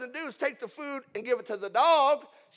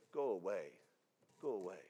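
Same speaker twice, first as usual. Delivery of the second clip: much lower and softer; quiet dismissal; 12 dB quieter than usual. Acted. Jesus' people turning seekers away, quietly dismissive. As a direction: send them off in a low, hushed voice.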